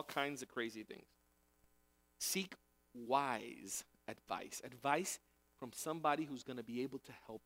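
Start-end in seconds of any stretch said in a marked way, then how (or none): 2.9-4.6 wise drawn out slowly, low and heavy; slow weighty emphasis on wise; stress on wise. Shown as a demonstration. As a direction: lean hard and slow on wise. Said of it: "emphasis on wise".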